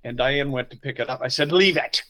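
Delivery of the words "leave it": louder, higher command; sharp order. Retold or imitated. Pastor to his wife Diane, a sharp angry order. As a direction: snap it like you're fed up.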